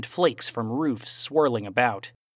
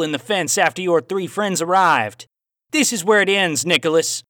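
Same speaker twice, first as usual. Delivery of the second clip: louder, raised Aussie voice with a cowboy twang; Aussie cowboy accent; 6 semitones higher, 6 dB louder than usual. A narrator's reading. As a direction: play the villain Aussie, hint of cowboy twang. Villain's dialogue in story.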